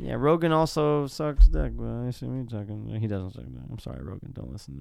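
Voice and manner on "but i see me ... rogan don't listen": sheepish. Real, not acted. Backtracking apology to Rogan.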